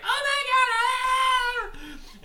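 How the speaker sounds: high, loud squealing shriek; fangirl squeal. Retold or imitated. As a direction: squeal like a starstruck fan.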